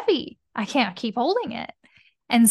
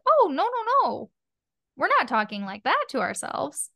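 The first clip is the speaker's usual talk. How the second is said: scolding "oh no"; 3 semitones higher than usual. Retold or imitated. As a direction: playfully shut down the bad habit. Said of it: "And I'm like".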